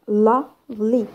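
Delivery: slow "lovely", LOVE stressed, then -ly; stress on first syllable. Shown as a demonstration. Teacher giving adjective examples, first-syllable stress on "lovely". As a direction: draw out "lovely" in two parts, stressing LOVE. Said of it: "adjective first syllable".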